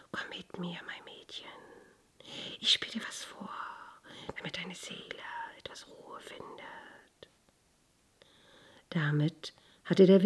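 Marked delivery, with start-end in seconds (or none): 0.1-7.3 soft, soothing voice of the wind; gusting windy whisper. Acted. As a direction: breathe it gently like a breeze. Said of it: "flüsterte der Wind". (sanftes Windflüstern)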